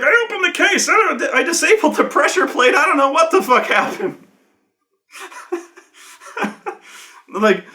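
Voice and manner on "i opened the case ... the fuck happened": high, frantic self-defence; acted panic. Game master as the player excusing himself, acted panic. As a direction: protest in an imitated panic.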